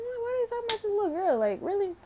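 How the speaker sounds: high, fast, incredulous outburst; shocked she'd mock a little kid; outraged disbelief. Teen scoffing at girls who picked on a seven-year-old, high incredulous outburst. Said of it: "I'm like".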